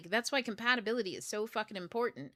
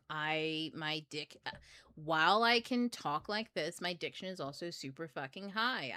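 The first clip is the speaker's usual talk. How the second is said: ordinary delivery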